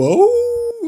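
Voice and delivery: high drawn-out wolf howl. Retold or imitated. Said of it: "eu tô imitando um lobo". (uivo de lobo)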